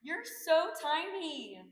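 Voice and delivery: high, drawn-out squeal settling down; gushing mom. Retold or imitated. Friend's mom complimenting weight loss at a party, gushing. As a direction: coo it slowly, high and loud at first, easing off. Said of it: "these words came from the mom".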